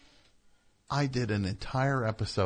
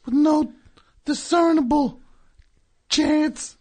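loud character voice; 7 dB louder than usual. Acted.

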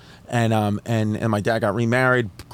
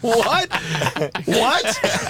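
high-pitched, baffled outburst; incredulous; 11 semitones higher than usual. Retold or imitated. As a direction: act out a son's stunned disbelief. Son baffled by dad's blame.

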